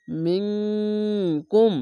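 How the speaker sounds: মিংকুম with the nasal hum held long and slow; ikhfa ghunna. Shown as a demonstration. Teacher showing Quran students ikhfa ghunna, long nasal hum. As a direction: draw out মিংকুম through the nose, slow and nasal. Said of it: "এখানে ইখফা গুন্না".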